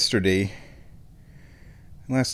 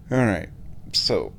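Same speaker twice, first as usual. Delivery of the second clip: usual speaking voice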